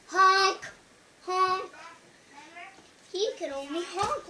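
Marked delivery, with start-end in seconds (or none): 0.1-1.7 loud, higher goose honks; two punched storybook honks. Acted. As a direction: honk it out like a goose. Imitating the pig's goose honk.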